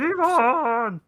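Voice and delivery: high, thin puppet frog call; Kermit the Frog. Retold or imitated. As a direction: shout the name like a cartoon frog. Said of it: "it's Kermit the Frog's voice".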